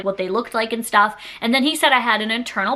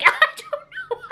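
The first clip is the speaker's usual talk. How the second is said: laughing. Real, not acted. Laughs at own story.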